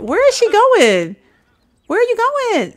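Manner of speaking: exasperated call. Real, not acted. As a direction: call after her in disbelief. Sim wandering off.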